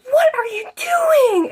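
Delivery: high-pitched indignant surprise; shocked. Retold or imitated. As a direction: shriek it in disbelief. Shocked at hair ripped.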